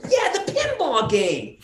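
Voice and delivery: high, slow mom voice with a laugh; imitating mom. Son mimics his mom, high and slow through a laugh. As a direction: drag out mom's cluelessness, laughing.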